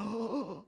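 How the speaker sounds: put-on sharp, wide-eyed child's gasp; imitated amazed gasp. Daughter watches dad juggling, imitated amazed gasp. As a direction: gasp in mimicked wonder.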